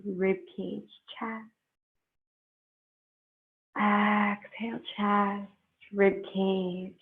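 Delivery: slow, unhurried meditation guide with long pauses; guided meditation. Real, not acted. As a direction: ease them into breath and stillness, leaving space between phrases.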